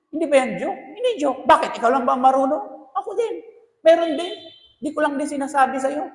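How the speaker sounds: mock quarrel between husband and wife; wife firing back that she cheated too; heated spouses. Spouses trade confessions.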